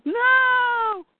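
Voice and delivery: high, drawn-out cry of "no"; anguished cry. Retold or imitated. Retelling a hero crying out at a killing, anguished cry. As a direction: cry out in protest, voice leaping up. Retold cry of protest in a comic.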